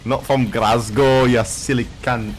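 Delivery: slow, drawn-out Scottish impression; put-on Scottish accent. Mimicking friend correcting his origins, slow mock Scottish. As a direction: imitate an affronted Scot, stretching the words. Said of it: "my Scottish accent is so good".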